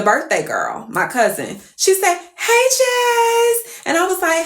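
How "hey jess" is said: very high, drawn-out squeal; excited greeting. Retold or imitated. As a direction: imitate the cousin's gushing hello. Acts out birthday cousin greeting her.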